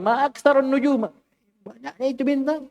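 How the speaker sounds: high, amazed exclamatory reading; exclamation of wonder. Shown as a demonstration. Teacher shows the wonder tune to the class. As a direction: say it like you're marvelling. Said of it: "Jangan beda intonasinya lah".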